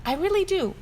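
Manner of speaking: put-on high, pleading little child voice; insistent child. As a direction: insist like a small stubborn calf.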